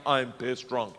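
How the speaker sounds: ordinary talk, nothing marked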